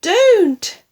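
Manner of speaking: very high, loud alarm; a sharp panicked cry to stop. A narrator's reading. Daughter stopping mother drinking it, high alarmed cry. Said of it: "cried Missus Cranky".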